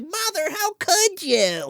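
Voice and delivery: very high, slow lament; mock betrayed wail. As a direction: draw it out like soap-opera anguish. Acting betrayed child confronting mother.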